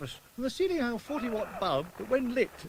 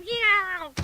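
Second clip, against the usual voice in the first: sudden high yelp of pain; a comic yowl as he lands; 11 semitones higher, 4 dB louder than usual. Acted. Tipped out of pram.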